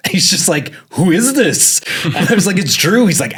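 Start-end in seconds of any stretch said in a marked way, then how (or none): 0.9-1.8 high, loud baffled "who is this"; confused boss. Retold or imitated. Boss on the phone hears a sudden quit, baffled high voice. 2.6-3.1 high, louder cheery answer; mock bright reply. Drew answers his puzzled boss, high and loud. Telling boss who's calling.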